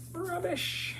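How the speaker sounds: a high, playful British "Rubbish."; mock British accent. Shown as a demonstration. [name] echoing her own word, mock British "Rubbish.". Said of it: "Who says rubbish?".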